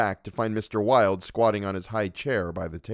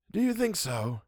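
high, soft, unconcerned question; amused high voice; 9 semitones higher, 4 dB quieter than usual. Acted. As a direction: shrug it off, higher and quieter. Wilde answers the threat.

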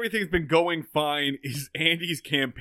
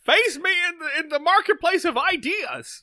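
much higher, louder pompous cartoon bellow; mayor of Townsville; 7 dB louder than usual. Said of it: "I kinda turned into the mayor of Townsville".

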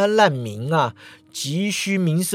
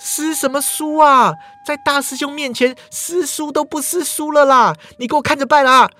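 much higher, whiny bossy heroine; a spoiled girl brushing off the complaint; petulant young woman; 9 semitones higher, 4 dB louder than usual. Acted. Mingyue scolding playfully.

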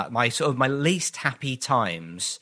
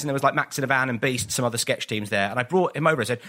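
normal talking voice

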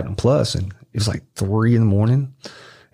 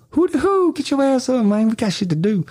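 much higher, cartoonish buddy hollering; squeaky mock voice rousing a sleeping friend; mock high voice waking a friend through the window; impatient wake-up call. Crunchy banging on window, waking him.

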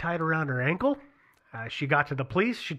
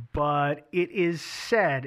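SAID drawn out slowly; slow down to underline "said"; stress on SAID; much slower than usual. Real, not acted. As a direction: lean deliberately on the word said, stretching it. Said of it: "I'm gonna emphasize the word said".

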